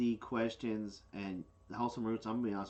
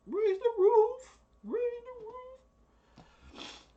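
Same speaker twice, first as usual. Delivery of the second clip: falsetto cheer; 8 dB louder, much slower than usual. Pretend. Celebrating new baby turkeys.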